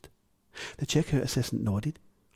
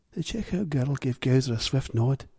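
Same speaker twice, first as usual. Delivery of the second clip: plain reading voice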